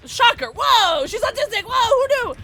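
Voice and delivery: loud, high, sarcastic amazement; hammed-up high-pitched surprise; mock shock. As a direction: fake being stunned, loudly. Mock shock at own reveal.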